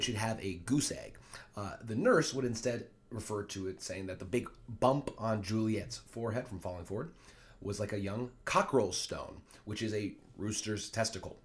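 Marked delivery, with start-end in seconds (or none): none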